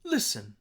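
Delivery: Listen, high first syllable falling to low; stress on LIS. Shown as a demonstration. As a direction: punch LIS in Listen, drop the second syllable. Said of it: "lis, is higher".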